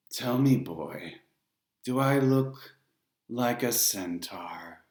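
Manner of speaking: put-on slow, odd, accented menace; Charon's foreign lilt. A narrator's reading. Ferryman mocking a boy, slow foreign lilt. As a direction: toy with the boy, slowly. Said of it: "He had a strange sound to him".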